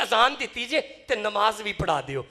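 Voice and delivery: very loud public request; loud appeal. Acted. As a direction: project the request forcefully to a whole crowd. Same request redone loudly for crowd.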